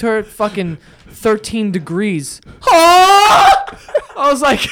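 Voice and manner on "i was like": loud laughing. Real, not acted. Laughing while retelling.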